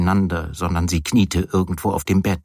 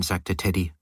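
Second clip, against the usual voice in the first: plain reading voice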